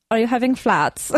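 playful fake German accent, laughing; light German accent with a laugh; mock German accent. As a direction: ham up a German accent on flats. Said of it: "Like how a German person is like".

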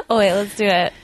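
ordinary delivery

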